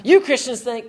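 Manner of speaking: high, loud scornful jeer; scornful accuser. Retold or imitated. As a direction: sneer it out at them.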